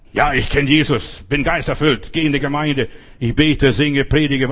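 imitated cocksure boasting; mock self-assurance. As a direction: rattle off your merits as a stubborn believer. Mocking stubborn self-assured believer.

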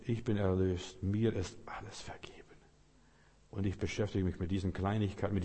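hushed, dropping into a whisper; quiet confession. Shown as a demonstration. Preacher models a believer's hushed inner confession. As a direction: say it softly to yourself, like a secret certainty.

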